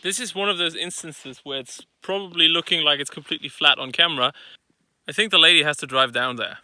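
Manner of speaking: ordinary talk, nothing marked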